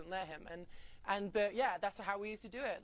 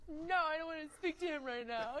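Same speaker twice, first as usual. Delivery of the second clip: higher-pitched, huffy retold protest; upset refusal; 8 semitones higher, 4 dB louder than usual. Telling the girl she won't come out, upset refusal. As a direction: refuse him in a raised, put-out voice. Retells refusing to see mentor.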